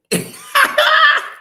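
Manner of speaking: high cackling laugh; loud high laugh. Real, not acted.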